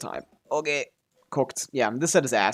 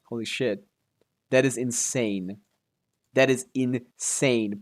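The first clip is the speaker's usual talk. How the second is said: slow, spaced-out emphasis; awed disbelief; slower than usual. Real, not acted. Gamer amazed by perfect rolls, slow awed emphasis. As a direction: draw out each word, breaking up "insane".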